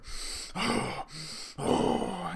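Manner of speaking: big, exaggerated deep breath blown out; loud boisterous breathing. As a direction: mimic someone breathing loudly for attention. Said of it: "breathe really deep and really loud and kind of boisterously".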